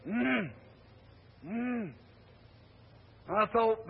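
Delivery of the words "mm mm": dying man's moan. Retold or imitated. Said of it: "He said".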